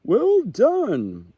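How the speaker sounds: high, loud cheering call. A narrator's reading. The fawn cheering Little Owl as she flies, high loud call. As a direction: shout praise after a flying owl. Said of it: "the fawn called out".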